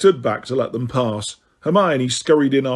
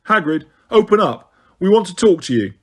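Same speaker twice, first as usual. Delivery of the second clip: calling out loudly; 5 semitones higher than usual. A narrator's reading. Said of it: "knocked loudly".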